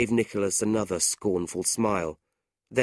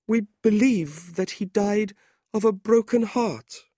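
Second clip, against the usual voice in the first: tearful widow; 13 semitones higher, 5 dB louder than usual. A narrator's reading. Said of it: "her eyes filled with tears".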